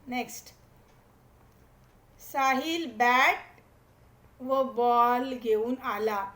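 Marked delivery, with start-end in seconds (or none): 2.2-6.2 slow dictation. Shown as a demonstration. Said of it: "teacher reading sentence slowly".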